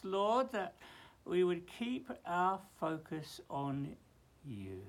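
slow, hushed earnest prayer. Acted. Prays aloud.

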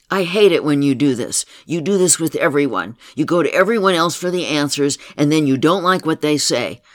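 loud, hurt outburst; angry. Pretend. Therapist role-playing the client's inner child, angry and loud. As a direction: lash out at her for leaving you. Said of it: "I said with anger".

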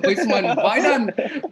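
slow, laughing through it; cracking up at the nickname. Real, not acted.

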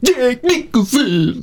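very high, drawn-out silly voice; Spencer. Acted. Co-host mimicking Spencer saying the actor's name, squeaky impression. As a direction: stretch the name out in a squeaky mock voice. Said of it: "That's my Spencer impression".

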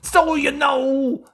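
high, loud, drawn-out comic impression; Bill Cosby. Podcaster riffing on pudding for the cohost, exaggerated celebrity impression. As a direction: stretch the words slowly in a hammed-up celebrity voice. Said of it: "didn't think Bill Cosby and my bad voices".

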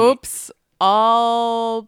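loud, drawn-out announcer-style punchline; slogan shout. Pretend. Player joking about bloody cereal, slogan shout.

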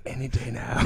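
breathy mock ASMR whisper with giggles. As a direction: whisper it, barely holding in laughs. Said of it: "We're on the whisper portion".

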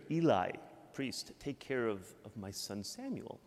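ordinary talk, nothing marked

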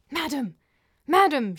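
high, urgent hissed call. A narrator's reading. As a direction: hiss it loudly to get her attention. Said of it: "she hissed".

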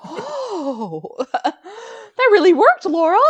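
high, drawn-out mock amazement; hammed-up awestruck realization; amazed friend. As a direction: imitate an astonished friend, rising high and loud. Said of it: "my friends are like".

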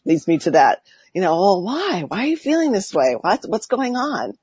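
usual speaking voice

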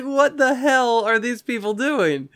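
high-pitched drawn-out disbelief; hammed-up high bafflement; incredulous. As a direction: squeal it slowly, fresh from laughing. Still cracking up, retells his disbelief.

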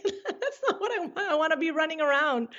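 high-pitched, laughing recollection; amused mock objection, voice lifted by laughter; laughing protest. Laughs recalling her youthful protest.

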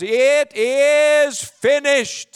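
very slow, high drawn-out cry; Jesus crying out. Retold or imitated. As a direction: stretch the last words of the cross. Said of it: "Jesus cries".

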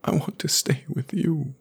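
tearful plea. A narrator's reading. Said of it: "he was crying".

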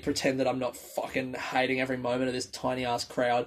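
ordinary delivery

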